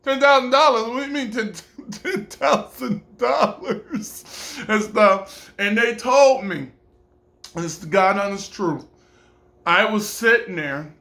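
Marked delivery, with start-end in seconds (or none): 0.0-5.5 high, stunned repeating of the number, breaking into a laugh; can't believe the price, laughing; shocked disbelief. Retold or imitated. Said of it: "I was like".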